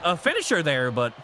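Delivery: ordinary talk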